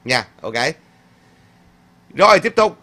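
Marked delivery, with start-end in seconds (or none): 2.1-2.8 raised, high call to move on; loud cheerful cue. Real, not acted. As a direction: call out the next item to the chat. Host moves to next comment.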